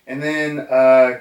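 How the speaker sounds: usual speaking voice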